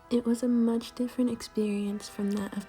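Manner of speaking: review voiceover. Acted. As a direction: read the verdict like a recorded game review. Recorded review voiceover read.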